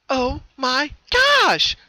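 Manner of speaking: high, loud, drawn-out gasp of joy; slow, high joyful outburst; sudden excited cry. Acted. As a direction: burst out in amazement. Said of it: "cried out suddenly".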